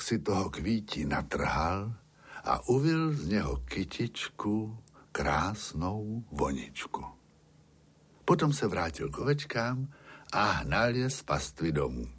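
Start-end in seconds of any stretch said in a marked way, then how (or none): none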